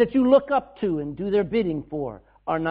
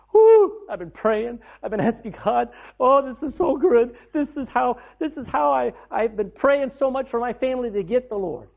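excited praise; 6 semitones higher, 6 dB louder than usual. Retold or imitated. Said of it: "Gavin going".

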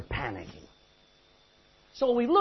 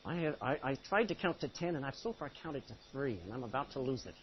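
mock exasperated mom; 9 semitones lower, 14 dB quieter than usual. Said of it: "did your mom ever tell you this?".

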